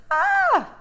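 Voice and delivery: scream. A narrator's reading. Tiana screaming at the talking frog. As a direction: yelp in fright. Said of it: "Tiana screamed".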